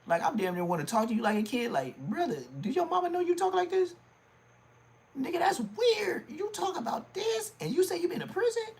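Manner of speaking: very high-pitched, incredulous impression; scolding, squeaky put-on voice. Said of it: "I'ma start talking like [name]".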